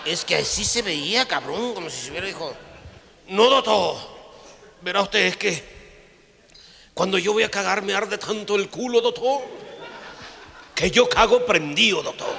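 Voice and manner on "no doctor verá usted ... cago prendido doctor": high, loud, strained confession; hammed-up miserable patient; suffering patient. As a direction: whine out the painful truth. Patient explaining burning pain.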